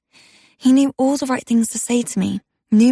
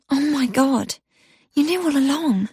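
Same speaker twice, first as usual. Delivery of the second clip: gasped; 4 semitones higher than usual. Acted. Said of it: "I gasped".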